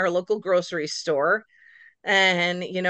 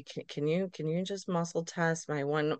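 soft, halting request; sheepish; 10 dB quieter than usual. Retold or imitated. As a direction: ask timidly, stumbling into the favour. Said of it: "kinda sheepishly".

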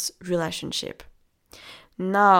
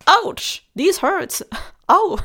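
high, loud playful yelp of pain; hammed-up ouch, half laughing; mock ouch; 11 semitones higher, 7 dB louder, slower than usual. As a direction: pretend it stings and squeal it out. Acting out hitting own knees.

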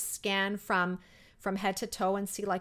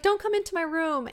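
daughter's voice; 7 semitones higher, 5 dB louder than usual. Retold or imitated. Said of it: "she's like".

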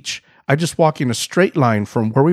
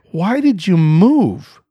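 slow, higher, drawn-out demand; retold mad; 4 semitones higher, 3 dB louder, much slower than usual. Husband confronting wife on the beach, retold mad. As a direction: confront her in mock outrage, stretching the words. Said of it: "I'm mad".

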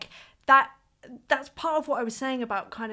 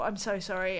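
ordinary talk, nothing marked

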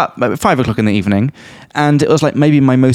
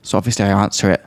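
usual speaking voice

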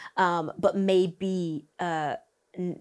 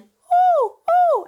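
very high, loud "Ooh, ooh"; very high hoot; 9 dB louder than usual. Shown as a demonstration. Coach demos a high hoot sound for the student. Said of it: "which is right in the middle".

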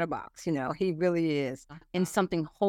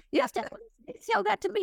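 put-on much higher, fast child's voice; imitating grandson; faster than usual. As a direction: squeak it out like an eager little boy. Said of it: "He goes".

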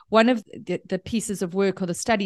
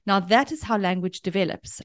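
usual speaking voice

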